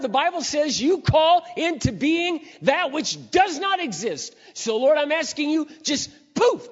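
high, loud pleading cry to God, slowing at the end; desperate loud prayer. Retold or imitated. A father out of work crying out to God in prayer, loud and high pleading. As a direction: beg the Lord out loud for a job.

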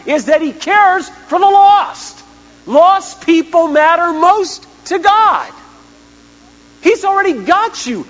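preaching climax, voice straining; impassioned shout. Real, not acted. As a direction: cry it out at the peak. Climax of sermon.

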